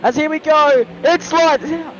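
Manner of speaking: high, loud race-start call; motorsport commentator. Acted. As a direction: call the lights out like live TV.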